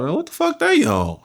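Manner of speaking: high-pitched startled disbelief; shocked. Retold or imitated. Young inmate to his cellmate, high shocked disbelief. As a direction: jump way up in pitch, caught off guard. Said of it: "I'm like".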